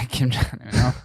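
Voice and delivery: laughing. Real, not acted. Laughing at the pun.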